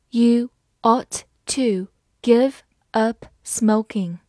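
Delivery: slow, each word set apart; slow read-aloud. Shown as a demonstration. Teacher reading advice to quit smoking for learners, slowly. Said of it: "好，慢慢来读".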